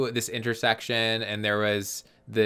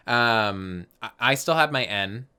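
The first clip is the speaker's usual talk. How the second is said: normal talking voice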